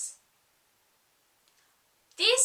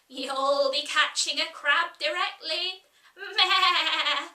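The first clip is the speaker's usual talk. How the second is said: louder scold ending in a laughing bleat; bleating Sheep; 3 semitones higher, 5 dB louder than usual. Acted. Sheep warning Alice, ending on a bleat. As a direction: tease like an old ewe, then bleat.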